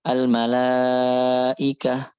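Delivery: AL-MALAIKAH very slow, long held "laa", clean "ikah"; measured hamzah. Shown as a demonstration. Teacher models reading in due portion, measured hamzah in AL-MALAIKAH. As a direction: stretch "laa" in "Al-malaikah", then an unforced catch. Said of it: "baca sesuai dengan porsinya".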